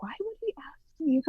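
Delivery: breathy whisper of a private doubt; whispered inner thought. Retold or imitated. Guest whispering her own doubt about the invite. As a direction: whisper it to yourself, puzzled. Wondering why she was invited.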